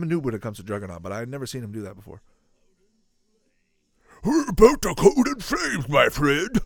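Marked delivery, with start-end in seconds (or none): 4.2-6.6 high, loud, drawn-out put-on voice; hammed-up character threat. Gamer voicing a fiery threat to a foe, hammed-up. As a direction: play it big, stretching the words.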